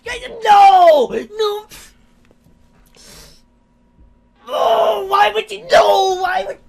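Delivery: high, loud, wailing protest; anguished outrage. Real, not acted. Player whose scene got interrupted, anguished shout. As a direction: cry out in disbelief, nearly shouting.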